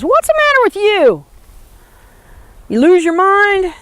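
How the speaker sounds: high, incredulous buddy; a hint of a bewildered old cowboy; [name]'s puzzled voice. [name] asks if he's lost it.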